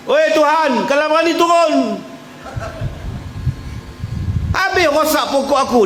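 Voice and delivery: shaking a fist at heaven, a full-throated yell; angry farmer. Retold or imitated. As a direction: yell up at the sky in rage. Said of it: "Dia marah banjir".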